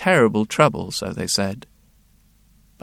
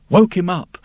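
startled jolt; 5 semitones higher, 6 dB louder than usual. A narrator's reading. Repeated, louder, higher.

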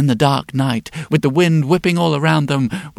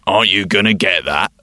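robber voice; 7 semitones lower than usual. Acted. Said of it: "one of the robbers said".

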